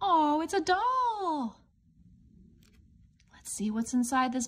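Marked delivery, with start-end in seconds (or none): 0.1-1.6 delighted surprise. Acted. Opening the red box.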